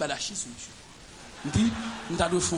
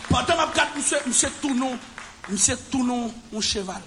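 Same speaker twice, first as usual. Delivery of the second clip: ordinary talk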